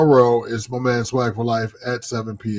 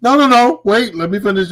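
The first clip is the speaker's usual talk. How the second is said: much higher and louder; insistent interruption; 10 semitones higher, 8 dB louder than usual. Real, not acted. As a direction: cut in loudly to hold the floor. Cuts in on co-host to finish.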